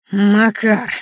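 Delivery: put-on loud, slurred drunken shout. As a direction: bark it out like a drunk thug. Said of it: "пьяным голосом воскликнул Бурый".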